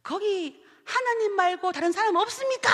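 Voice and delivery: calling out. Retold or imitated. Punchline shout for anyone else.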